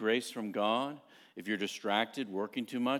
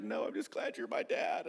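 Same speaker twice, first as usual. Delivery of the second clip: whispered through tears, breathy hush; a child's teary whisper; soft tearful whisper; 9 semitones higher, 6 dB quieter than usual. Retold or imitated. A daughter tells her dad, whispering through tears.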